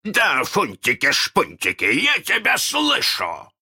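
high, drawn-out pirate captain shout; booming mock-captain reply, pitched high; imitating Hook's voice. A narrator's reading. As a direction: mimic the captain calling back. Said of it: "ответил Крюку его же голосом".

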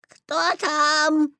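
put-on high old woman voice; boy faking a granny's voice; grandma voice. A narrator's reading. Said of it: "стараясь говорить бабушкиным голосом".

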